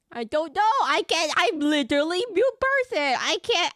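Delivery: high, loud, flustered protest; hammed-up helpless outburst; mock panic.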